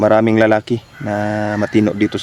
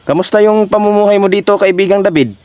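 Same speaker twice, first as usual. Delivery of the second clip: high sing-song address; 10 semitones higher, 3 dB louder than usual. Acted. Talking sweetly to the spirit.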